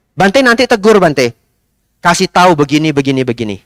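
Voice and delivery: lightly voiced pushy young man; young man ordering. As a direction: tell the monk what to say. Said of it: "Saya diperintah lagi".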